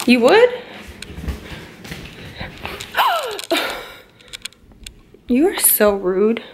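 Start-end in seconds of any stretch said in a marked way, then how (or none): 2.4-3.8 high, hammed-up gasp of shock; teasing fake gasp; mock gasp. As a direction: gasp like you're scandalized. Mock shock at his answer.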